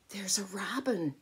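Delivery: excited hushed whisper. Real, not acted. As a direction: whisper it like a discovery.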